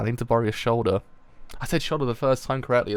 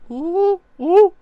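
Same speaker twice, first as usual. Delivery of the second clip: two very high, loud oohs; high-pitched ooh; 11 dB louder than usual. Real, not acted. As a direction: squeal "ooh" twice, way up high. Spontaneous sound during the reading.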